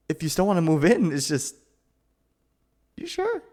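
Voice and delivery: stammer, caught off guard and thrilled; flustered surprise. Acted. To the friend wanting to move in, a flustered high squeak.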